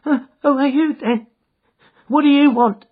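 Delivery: quivering voice. Acted. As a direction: ask as if caught in the act. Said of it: "he asked in a quivering voice".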